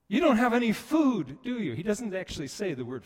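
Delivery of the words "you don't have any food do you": high, loud shouted question; calling out. Retold or imitated. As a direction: shout the question across to the boat. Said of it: "a voice calls out".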